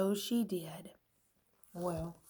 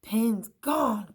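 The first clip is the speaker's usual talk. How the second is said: cried; 3 semitones higher, 7 dB louder than usual. A narrator's reading. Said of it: "cried Pencil".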